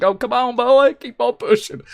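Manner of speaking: high, slow mock cheer of encouragement; coaxing the truck. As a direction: egg it on like a rooting driver, drawn out. Coaxing the stuck truck along.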